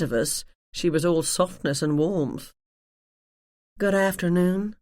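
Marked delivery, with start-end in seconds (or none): 3.8-4.7 slow, terribly posh drawl. Acted. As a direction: drawl it like a snooty receptionist. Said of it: "said in a terribly posh drawl".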